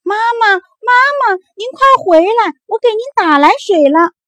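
put-on the youngest child running with water, shouting for mother; calling out. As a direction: shout across the distance, desperate. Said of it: "一边追一边喊".

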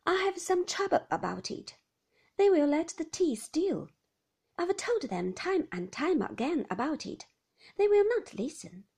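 a carer airing a small grievance; complaining nurse. Acted. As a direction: nurse complaining to Beatrice about the tea. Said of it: "she said, nodding at Beatrice".

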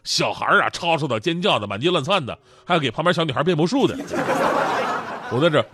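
normal talking voice

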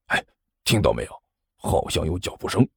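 low and hushed, listening for footsteps; hushed suspense. Acted. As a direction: drop the voice low, alert. Boss hears footsteps, hushes.

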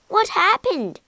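high, loud startled question; alarmed cry. A narrator's reading. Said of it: "cried her mother".

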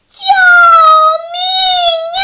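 heart-rending high scream for help; piercing scream. A narrator's reading. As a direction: shriek for help, stretching each word out. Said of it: "一声撕心裂肺的尖叫".

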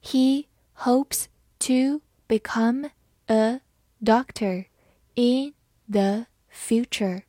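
slow, word by word read-along; slow dictation pace; slowly. Shown as a demonstration. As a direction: spell out each word slowly for learners. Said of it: "好，慢慢来读。".

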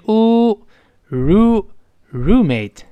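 slow “roommate” built up from u and ru; long u. Shown as a demonstration. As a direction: draw out the u, then join it into “roommate”. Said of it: "后面发长音u". (拉长u，慢慢拼出“roommate”)

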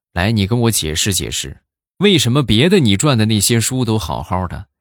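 mock indignation building to high, loud; scolding teacher. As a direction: act out an exasperated teacher, climbing in pitch. Teacher scolds pupil over book.

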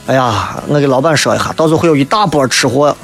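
ordinary talk, nothing marked

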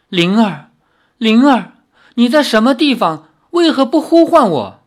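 loud, high desperate cries into the wilderness; anguished calling out. A narrator's reading. Hero calls for his lost wife across the wilds, loud anguished cry. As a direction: call her name with all your strength, high and loud. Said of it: "李逍遥不停地呼喊着".

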